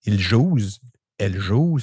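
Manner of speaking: "ils jouent" then "elles jouent" said carefully in standard French; standard pronouns demo. Shown as a demonstration. Showing a friend the standard pronoun forms. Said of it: "t'es comme".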